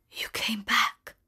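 slow, soft whisper; the dying beast whispers to Belle; weak whisper. A narrator's reading. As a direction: breathe it out, faint and slow. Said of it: "he whispered".

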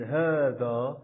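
هذا with a long, held "haa"; unwritten alif sounded. Shown as a demonstration. Teacher illustrating the unwritten alif, هذا slowly stretched as haadhaa. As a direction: draw out both vowels of هذا, haa-dhaa. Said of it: "ولكن نطقا نلفظه".

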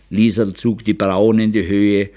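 ordinary delivery

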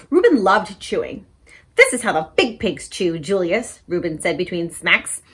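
put-on lively picture-book read-aloud for kids; story time reading. A narrator's reading. Librarian reading a story to children, animated. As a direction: read it bright and animated to little listeners.

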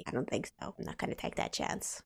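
hushed under the breath; whisper. Real, not acted. Not waking the baby.

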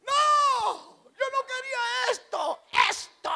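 high shrieking mother-in-law, shouted; mother-in-law screaming. Retold or imitated. Son-in-law mimicking his mother-in-law screaming at the pregnancy news.

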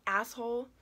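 mild yelled insult. A narrator's reading. Knife grabber to the gunman, mild yell. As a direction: yell the insult, slightly held in. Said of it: "and yells at Frosty".

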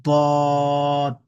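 দদ said loud and held, thick and heavy; Arabic dad letter, loud and held; emphatic দদ. Shown as a demonstration. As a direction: sound দদ full and heavy from the back. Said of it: "এই হরফটার নাম হচ্ছে".

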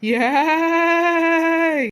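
long, high, loud "ey"; mock-menacing drawn-out shout; ghostly yell. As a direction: scare someone off with a held holler. Fake ghost scares the other away.

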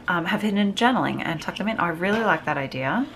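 ordinary talk, nothing marked

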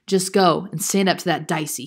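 ordinary delivery, nothing marked

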